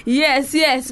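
ordinary delivery

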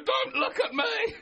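high, slow, laughing plea; mock tearful plea. Retelling crying at a film, high slow laughing plea. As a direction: beg her not to look, half laughing.

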